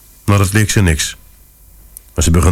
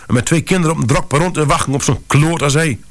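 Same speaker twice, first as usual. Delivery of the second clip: furious; 8 semitones higher than usual. Acted. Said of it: "Mevrouw was razend".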